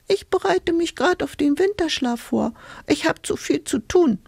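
very high hedgehog voice; annoyed squeak. A narrator's reading. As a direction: squeak a busy, grumpy refusal. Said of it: "quietschte da ein Igel ärgerlich".

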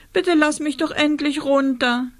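high, pitiful begging; plaintive plea. A narrator's reading. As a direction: beg miserably to be let go. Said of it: "sagte Rosa kläglich".

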